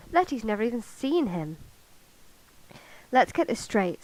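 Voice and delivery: animated, projected book reading; story narration. A narrator's reading. Vlogger reading a novel passage for viewers, animated storytelling. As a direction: read the story aloud with lively character dialogue.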